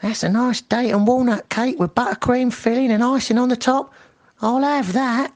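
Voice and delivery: a greedy lady thief; high-pitched acquisitive burglar; intruder voice. Acted. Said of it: "The voice says".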